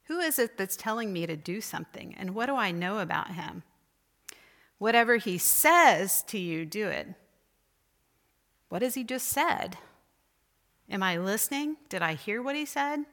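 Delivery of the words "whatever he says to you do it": SAYS punched loud and falling; stress on SAYS. Shown as a demonstration. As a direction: hit the word says hard. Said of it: "each time you emphasize a different word or phrase".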